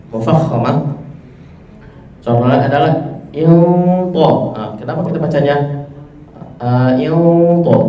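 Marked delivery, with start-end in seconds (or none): 3.3-4.3 "ilto" full and round, heavy t; heavy tafkhim. Shown as a demonstration. Teacher shows a thick, heavy "ilto". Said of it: "suaranya lebih tebal, lebih berat". 7.1-7.9 "ilto" full and round, heavy t; heavy tafkhim. Shown as a demonstration. Teacher shows a thick, heavy "ilto". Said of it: "suaranya lebih tebal, lebih berat".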